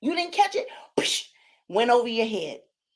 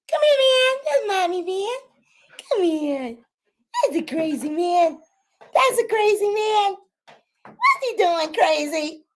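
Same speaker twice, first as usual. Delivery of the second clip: high-pitched cooing to a puppy; high doggy-talk voice; playful pet talk; 8 semitones higher than usual. Real, not acted. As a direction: talk high and silly to your dog. Talking playfully to her puppy.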